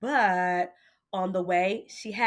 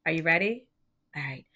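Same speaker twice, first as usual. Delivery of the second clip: ordinary delivery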